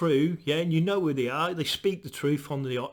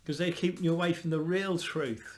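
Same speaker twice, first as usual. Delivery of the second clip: ordinary talk, nothing marked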